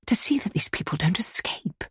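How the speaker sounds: urgent unvoiced whisper; low rapid whisper. A narrator's reading. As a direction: whisper the warning so no one overhears. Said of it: "she said rapidly in a low whisper".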